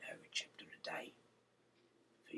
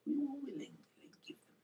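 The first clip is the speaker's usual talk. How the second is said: much higher whispered voice of the crowd; eager crowd; 5 dB louder than usual. Acted. The Israelites eagerly answering Gideon, high willing voice. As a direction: lift the voice high and eager for the people.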